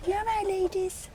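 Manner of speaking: high cooing voice to the hens; pet talk. Real, not acted. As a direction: coo sweetly up high at the chickens. Cooing to her chickens.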